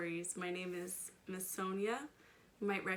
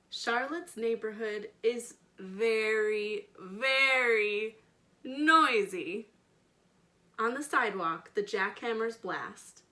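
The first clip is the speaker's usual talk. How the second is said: slow, louder storybook reading with big emphasis; reading to children; 8 dB louder than usual. A narrator's reading. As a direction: paint each noise for the little listeners. Expressive read-aloud narration.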